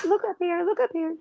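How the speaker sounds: high call to look up; calling down from balcony. Real, not acted. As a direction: coax the bird to look up. Calling to the bird.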